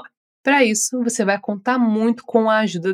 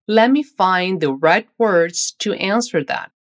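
slow, careful full sentence; 3 semitones lower, slower than usual. Shown as a demonstration. Teacher putting the whole sentence together slowly. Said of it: "Agora vamos juntar tudo".

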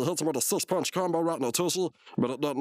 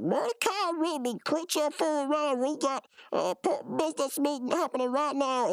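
mock a boss ordering slackers around, pitched up; Benson; 13 semitones higher than usual. Boss breaking up the laundry game, high mock scolding. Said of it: "Bessie come in like".

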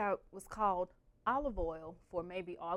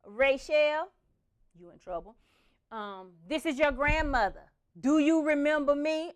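louder, stern grandma voice; stern grandmother; 6 semitones higher, 8 dB louder than usual. Retold or imitated. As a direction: play grandma leaving a scolding voicemail.

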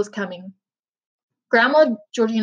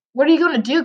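higher-pitched anxious question; narrated cry, voice raised in pitch; a touch of alarm; 5 semitones higher, 3 dB louder, faster than usual. A narrator's reading. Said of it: "cried Charlie".

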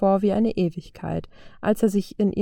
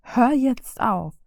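higher, slow, drawn-out order; firm command; 4 semitones higher, much slower than usual. A narrator's reading. Vio orders Tony to stop shoving, firm. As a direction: stretch it out to make him stop. Vio stops Tony's fight.

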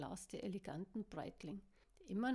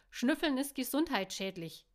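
loud scolding, higher; loud scold at her friend; 4 semitones higher, 10 dB louder than usual. A narrator's reading. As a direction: tell her off sharply. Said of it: "schimpfte Em".

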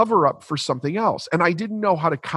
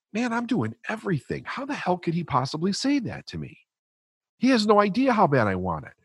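a hint of wounded pride, voiced higher; exasperated inner rant; 7 semitones higher than usual. Indignant inner thoughts about trainer.

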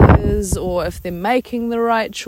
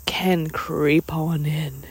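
whisper; 3 semitones lower, 5 dB quieter, slower than usual. Real, not acted. Whispered aside.

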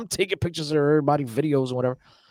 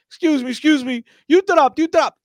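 high-pitched clownish heckle; clowning; 12 semitones higher, 5 dB louder than usual. Retold or imitated. Cutting in at a press conference for laughs, clownish. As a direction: call it out high and loud like a clown. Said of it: "trying to be a clown".

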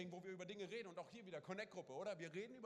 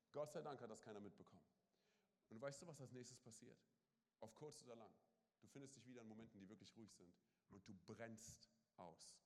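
low, much softer, slowing to a near stop; hushed; 8 semitones lower, 8 dB quieter than usual. Acted. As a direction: draw the church in close and quiet. Preacher drops to hushed tone.

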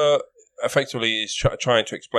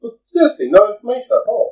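high, light woman's voice; 11 semitones higher, 3 dB louder than usual. Acted. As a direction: pitch it up as the lady. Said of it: "do a woman's accent".

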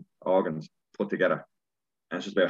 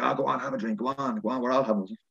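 mocking peer pressure; 6 semitones higher than usual. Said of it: "they're like".

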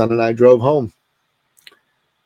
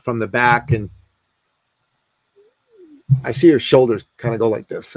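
usual speaking voice

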